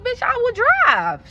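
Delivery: very high, slow mock outrage; mock outraged shout. Birthday girl daring the driver back, high mock outrage. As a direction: hit it high and drawn out like a dare. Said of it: "So she like".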